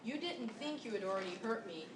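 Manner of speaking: ordinary delivery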